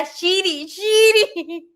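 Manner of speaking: high, loud calling of a nickname; imitated grandma calling. Grandma calling granddaughter by mispronounced name, loud high call. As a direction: call out as grandma did, rising in pitch and volume. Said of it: "pero no me podía decir Osiris".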